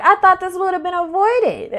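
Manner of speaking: lightly voiced exasperation with a laugh; high, giggling retelling; laughing mock dismay. Acted partner with laugh.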